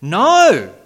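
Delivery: loud answering no; the saved man. Acted. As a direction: answer the law's charge frankly. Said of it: "says the man saved by grace".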